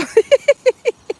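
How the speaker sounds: a real high laugh bubbling over; high burst of laughter. Real, not acted. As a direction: break into a high, loud giggle. Laughing at her phone battery.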